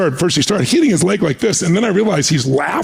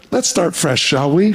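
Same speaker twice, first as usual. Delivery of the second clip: lightly voiced statesman's fresh start; imitated Reagan; slower than usual. President restarting talks after an argument, lightly imitated overture. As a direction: put on a light impersonation, offering a truce. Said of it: "He took two steps away and went back and went".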